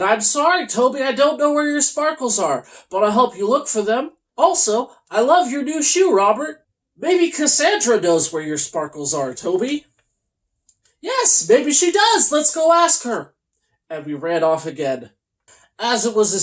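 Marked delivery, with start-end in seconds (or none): 0.0-9.9 bright high sympathetic voice; kindly higher character voice; high marshmallow voice. Acted. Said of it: "Tammy shrugged her shoulders and said". 11.0-13.3 excited. Acted. Toby eagerly agrees.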